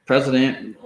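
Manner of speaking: normal talking voice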